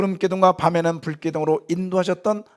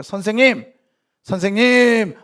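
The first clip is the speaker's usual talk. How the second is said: urgent calling; 6 semitones higher, 7 dB louder than usual. Pretend. Disciples waking Jesus.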